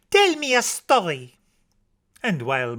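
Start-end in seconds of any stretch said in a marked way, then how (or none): 0.1-1.4 put-on high little-girl voice; Josette. A narrator's reading. Small girl asking Papa for a story, high child voice. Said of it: "says Josette".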